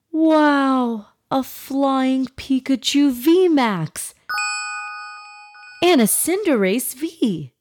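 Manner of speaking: high, slow amazed reading; awed boy. Acted. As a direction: read it out in wonder. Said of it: "read the two cards out loud".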